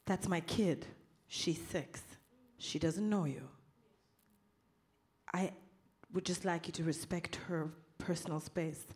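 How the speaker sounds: quiet, steady, holding back anger; calm and controlled. Retold or imitated. Calmly answering the train conductor.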